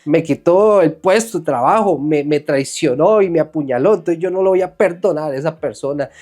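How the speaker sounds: acted indignation. Preacher voices a betrayed friend refusing to forgive, indignantly. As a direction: list the wrongs like someone refusing to forgive. Acts out an offended, unforgiving person.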